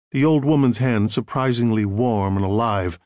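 usual speaking voice

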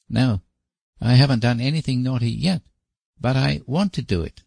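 put-on high boyish admission; little boy voice. A narrator's reading. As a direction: confess like a small boy. Davy the boy.

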